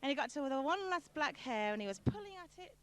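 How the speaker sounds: normal talking voice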